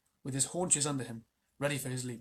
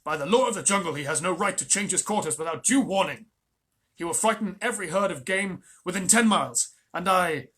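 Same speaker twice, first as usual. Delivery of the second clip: angry; 4 semitones higher, 10 dB louder than usual. Acted. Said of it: "Father Wolf began angrily".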